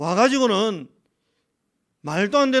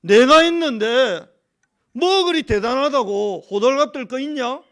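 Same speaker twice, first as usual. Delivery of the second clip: louder, higher, challenging voice of God; 하나님 말씀 흉내; 3 semitones higher, 8 dB louder than usual. Retold or imitated. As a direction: retell God's rebuke, stretching the words loudly. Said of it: "하나님이". (하나님이 따지시듯 크고 높게)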